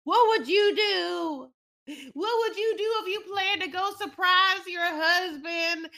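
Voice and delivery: hammed-up, high-pitched pleading; imitated self-justification. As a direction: appeal to the listener in a put-on voice. Acts out the woman justifying herself.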